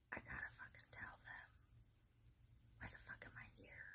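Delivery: hushed, exasperated reply; barely voiced, urgent aside; secret whisper. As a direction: whisper back so adults can't hear. Secret reply to kid in window.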